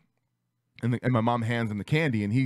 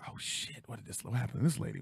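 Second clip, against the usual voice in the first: soft, voiceless aside; hushed alarm; 2 semitones lower, 9 dB quieter than usual. Retold or imitated. Shoppers watching mom make a scene, hushed. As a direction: murmur it like a startled onlooker.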